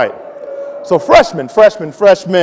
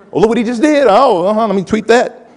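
higher, excited busybody voice; gossiping onlooker; 7 semitones higher than usual. Retold or imitated. People watching the mayor slip up, gossiping eagerly. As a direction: play a nosy onlooker, pitched up.

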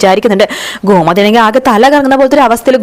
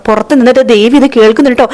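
ordinary talk, nothing marked

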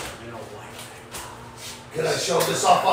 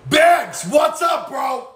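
shouted greeting; 8 semitones higher, slower than usual. Real, not acted. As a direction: call out to your buddy as he walks in. Greeting friend arriving at shop.

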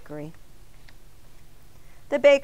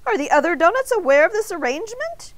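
high, loud incredulous question; scandalized raised voice; aghast; 10 semitones higher, 8 dB louder than usual. A narrator's reading. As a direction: demand it in shocked disbelief. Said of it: "Arnie gasped".

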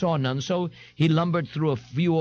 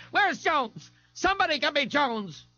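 much higher, sharp calls for help; demanding bar owner; 13 semitones higher than usual. A narrator's reading. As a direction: bark for Jones in a higher voice. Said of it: "Lana Lee asked".

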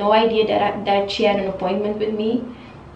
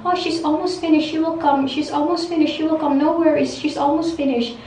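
higher, singsong mock reassurance; mocking salon staff; 6 semitones higher than usual. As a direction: imitate the staff brushing her off, higher. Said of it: "every ten minutes they will come".

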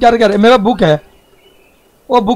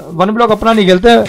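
usual speaking voice

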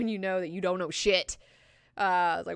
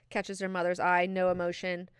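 ordinary talk, nothing marked

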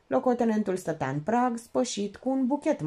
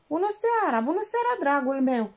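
gushing welcome; 8 semitones higher than usual. Acted. Gushing greeting.

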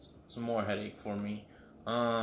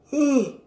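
loud high yawn sound; big yawn; 12 dB louder than usual. Real, not acted. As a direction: stretch out a yawn. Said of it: "We're yawning".

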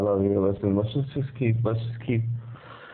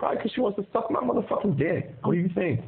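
high angry sarcasm; 9 semitones higher than usual. Real, not acted. Boyfriend mocking his girlfriend's jealousy, high sarcastic anger. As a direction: pitch shoots way up, sneering and angry. Angry phone quarrel over money.

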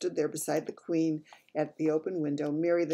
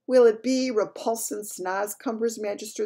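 BFG voice; 6 semitones higher, 7 dB louder than usual. Acted. BFG asks the Queen.

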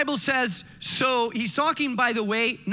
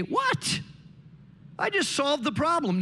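mock surprised husband; 5 semitones higher than usual. The husband, shocked, in the story.

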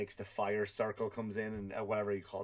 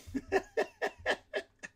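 a high, breathy burst of laughter. Real, not acted. Comedian laughing at his own story about the cinema, high laugh. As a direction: crack up at your own story.

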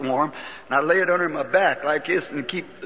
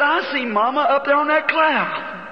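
put-on child's wonder; 10 semitones higher than usual. Small son speaks.

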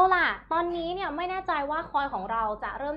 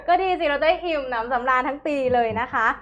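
laughing; 6 dB louder than usual. Real, not acted. Still laughing after joke.

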